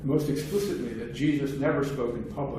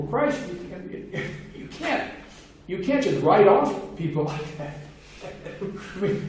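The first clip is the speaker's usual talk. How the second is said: laughing; 6 semitones higher than usual. Real, not acted. Laughs at the harsh idea.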